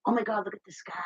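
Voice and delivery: high, soft amazed gasp; soft high "oh my God" in wonder; hushed awe. Retold or imitated. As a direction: breathe it in awe. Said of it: "I go".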